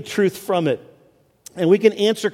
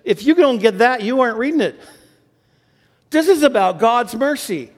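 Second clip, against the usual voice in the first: fervent preaching; 6 semitones higher than usual. Real, not acted. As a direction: preach it with rising conviction. Preaching at full force.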